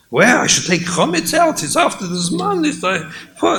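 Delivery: high, frantic panicking voice; mock frantic husband, way up high; panicked husband.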